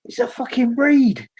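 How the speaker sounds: high, startled mock yell; half-acted panic cry; retold panicked shout. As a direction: yelp it like a raid is coming. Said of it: "he shouted".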